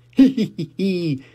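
high put-on hee hee laugh; spoken chuckle. Daddy Pig chuckling at Peppa, high. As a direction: chuckle it out like a jolly dad.

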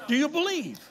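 high, loud challenge to the crowd; preacher pressing the congregation; preaching shout. Real, not acted. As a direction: fling the question out. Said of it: "preacher calls to congregation".

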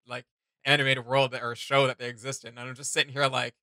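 normal talking voice

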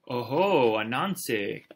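loud, swooping "oho"; big storybook leopard greeting; gloating leopard. A narrator's reading. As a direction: pounce on the greeting. Leopard greets his prey.

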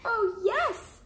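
high, eager little cry; excited cub. Acted. Cub seeing the snowball moon, excited cry. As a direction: burst out with joy like a cub. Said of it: "cried Baby Bear".

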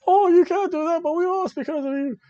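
high-pitched mock whining; Billie Kay. Wrestler blaming partner for the loss, high mock scolding. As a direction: imitate an upset partner in a squeaky high voice. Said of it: "Billie Kay's like".

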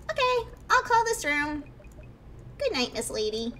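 put-on high, bouncy little kid teasing the player. Ghost kid teasing the startled player, high little kid voice. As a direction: play a cheeky ghost kid pretending to be normal. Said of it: "I'll just give it a little kid voice".

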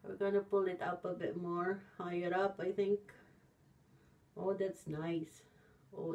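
wet sheet over lips; talking while smoothing mask; through a face mask. Real, not acted. Seen 1: pineapple sheet mask covers whole face throughout, mouth visible through opening.

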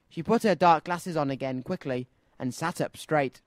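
usual speaking voice

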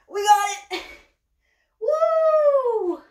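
high, loud triumphant whoop; real cheer of relief; excited cheer. Real, not acted. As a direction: celebrate getting the shot. Snake finally lifted into frame.

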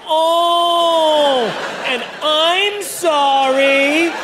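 mock recap of outbursts. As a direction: replay the embarrassing cries. Recap of his own outbursts.

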